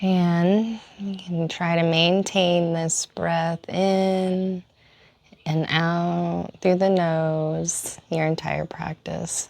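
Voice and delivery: slow, calm instruction with long pauses; guided yoga breathing. Acted. Yoga teacher leading the class into breathing, slow and calm. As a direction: stretch out the last words to pace each breath.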